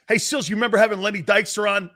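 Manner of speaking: rattled off fast, imitating fans; quick teasing question; fast mock caller. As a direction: rush it like an eager fan. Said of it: "People are gonna go like this".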